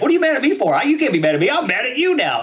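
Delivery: high-pitched mock argument; pretend drunken anger. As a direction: throw the blame back, play-acting a drunk. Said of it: "if I was drunk, I would have been like".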